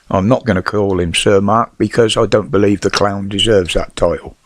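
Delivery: ordinary talk, nothing marked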